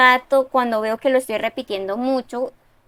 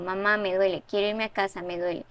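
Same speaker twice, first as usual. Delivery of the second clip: a hint of a hurt child's shaky, rasping voice; lightly voiced injured boy, a touch of tremble; trembling, raspy voice; 4 semitones lower, 7 dB quieter, faster than usual. A narrator's reading. Hurt boy tells his mother he is in pain, with only a hint of tremble. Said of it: "con voz temblorosa, raspada".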